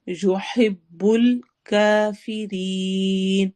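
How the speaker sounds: يُحِبُّ الْكَافِرِينَ with رین stretched at the stop; long madd. Shown as a demonstration. Teacher reciting for the class, slow. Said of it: "لا الف مدہ ہے".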